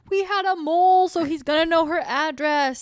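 high, loud imitation of panicked agents; mock freaking out. Podcaster retelling agents learning of the mole, mock freaking out. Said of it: "they're all freaking out 'cause they're like".

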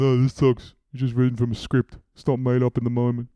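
sneering mock whine of a jaded theatergoer; mock soulless critic. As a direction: play a grumpy cynic moaning in the seats. Mocking a cynical audience member.

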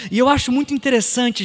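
normal talking voice

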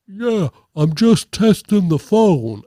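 slow, put-on grown-up voice; boy faking older man. Twelve-year-old boy pretending to be an older man, badly faked. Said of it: "twelve-year-old boy pretending to be an older man".

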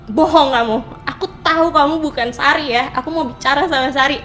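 mock-angry snarl at a liar; Mak Lampir fierceness. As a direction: imitate an old-film witch turning on someone. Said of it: "kayak mak lampir gitu loh jawabnya".

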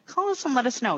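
usual speaking voice

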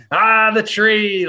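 high, loud, slow squeal of recognition; imitated excited yell; retold excitement. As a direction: cry out like a thrilled fan. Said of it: "I was super excited".

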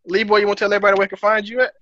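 usual speaking voice

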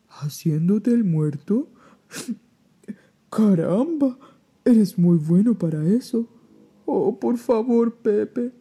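surprised, pleading bear. Acted. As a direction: beg like a big bear.

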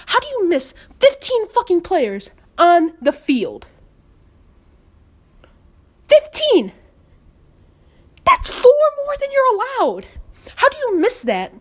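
high-pitched, incredulous fan rant; real disbelief, pitch leaping up; exasperated outrage. Real, not acted. Fan railing at referees over missed players, exasperated outrage.

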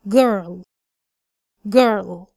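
put-on girl with lips rounded a little; rounded vowel. Shown as a demonstration. Teacher drilling girl with rounded lips. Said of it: "بنضم فيها الشفتين شوية".

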